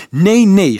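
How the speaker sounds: high, loud panicked refusal; shriek. A narrator's reading. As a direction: shriek it out in protest. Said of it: "gilde Nicolaas".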